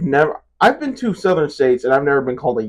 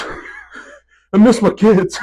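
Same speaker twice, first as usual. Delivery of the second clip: higher, hammed-up plea; mock pleading; 6 semitones higher, 4 dB louder than usual. Tired cameraman begging to see his kids, mock pleading. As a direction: beg like a worn-out captive, half laughing.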